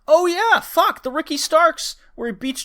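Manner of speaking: suddenly fired up; excited. Real, not acted. Excited outburst about promo.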